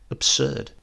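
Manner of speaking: plain reading voice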